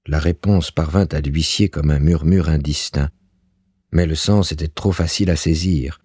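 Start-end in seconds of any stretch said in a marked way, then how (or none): none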